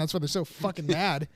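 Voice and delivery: usual speaking voice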